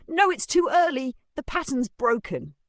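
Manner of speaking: high, loud frightened outburst; panicked cry. Acted. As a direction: cry out in sudden fear. Woman sees monster rising, gasps.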